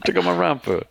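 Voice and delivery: high Brooklyn guy voice; hammed-up Brooklyn hustler; brooklyn accent. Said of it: "Went from Tony to Brooklyn".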